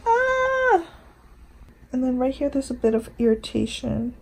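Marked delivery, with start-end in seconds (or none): 0.1-0.9 high short shriek; frightened shriek. Real, not acted. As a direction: yelp in fright.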